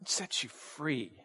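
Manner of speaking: very soft, low-key answer; hushed emphasis. Real, not acted. Preacher answering what truth does, hushed. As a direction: drop right down for the answer.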